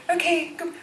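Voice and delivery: high, tearful laughing break. Retold or imitated. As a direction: choke up and laugh through it. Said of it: "up it came".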